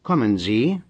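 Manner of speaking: "Kommen Sie?" as a rising question; rising question intonation. Shown as a demonstration. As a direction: question tune, voice lifting on "Sie". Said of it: "the voice rises at the end".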